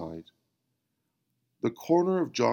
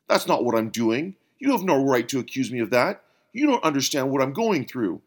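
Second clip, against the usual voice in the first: raised, heated denial; defensive; 4 semitones higher, 6 dB louder, faster than usual. Acted. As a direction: push back hard, feeling accused. Bill defends himself, accused.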